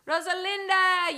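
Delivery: high, loud, drawn-out name call; excited calling out. Real, not acted. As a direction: call out the name with delight, slowly. Excitedly greeting a chat commenter.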